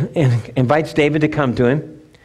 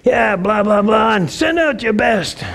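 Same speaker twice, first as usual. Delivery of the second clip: higher mock taunting giant; giant's taunt; 6 semitones higher than usual. Goliath mocking Israel's army, hammed-up taunt. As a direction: sneer up at the Israelites, lightly. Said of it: "he's taunting them".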